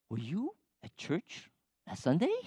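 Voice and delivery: acted-out surprise, rising in questioning steps; incredulous question. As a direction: ask haltingly, as if astonished. Surprised question to his son.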